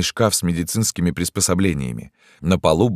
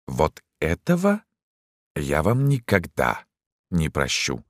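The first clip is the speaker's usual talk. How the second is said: cold resentment; 4 dB quieter, slower than usual. A narrator's reading. Doctor to Dantes who wrecked the train, coldly resentful. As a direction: measure it out slowly, sinking softer.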